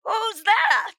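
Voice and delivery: high, put-on puzzled voice; a high mock whisper of who's that; puzzled mourners. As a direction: mimic the staring family. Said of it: "all looking at me like".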